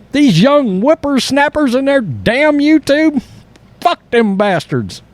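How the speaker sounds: put-on high, slow cranky old-timer voice; grumpy old man. Al Michaels griping at young YouTubers, cranky old-man voice. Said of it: "he's like".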